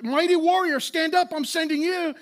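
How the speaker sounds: high, loud rallying command; God calling out the hesitant warrior, loud and stirring. Retold or imitated. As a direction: call him to rise up, booming. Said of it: "the Lord is saying to him, like".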